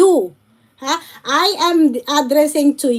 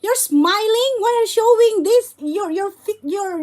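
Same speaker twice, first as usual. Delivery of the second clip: usual speaking voice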